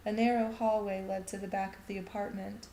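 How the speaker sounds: ordinary delivery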